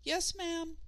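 put-on high meek boy reply; high child voice. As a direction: answer like an obedient boy. Child answers mother.

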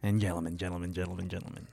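low echo that fades out; fading echo. Pretend. Echoing announcer bit.

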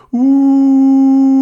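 high loud whoop; swinging whoop. Acted. Crane swings wide, a high loud whoop.

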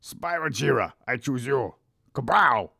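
high Pokemon trainer battle cry; overacted anime dub. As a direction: call out your monster like an anime hero. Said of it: "That sounds like an anime character".

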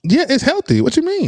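very high-pitched, baffled defence; defensive protest. Real, not acted. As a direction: push back with the voice shooting up. Defends himself against the mockery.

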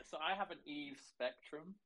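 muffled, hand covering the mic; finger over the mic. Real, not acted. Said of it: "you've gone a bit quiet".

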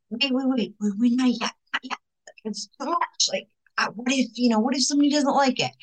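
a hint of panicky hesitation; mild nervous stalling. Stuffing Jesus back in the closet, anxious. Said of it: "being like".

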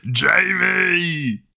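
high drawn-out call of Jamie; movie Jamie call. Retold or imitated. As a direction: sing out the name like the movie. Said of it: "I'll give my best".

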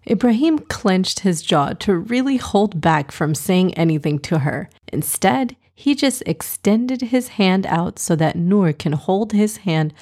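ordinary delivery, nothing marked

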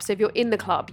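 ordinary talk

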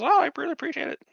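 a sweet, high-pitched thank-you; mock-sweet high actress voice; lightly mimicking the actress.

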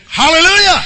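loud, high hallelujah; exultant preacher's call; rallying shout. Real, not acted. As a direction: shout it out to rouse the congregation. Rallying cry to congregation.

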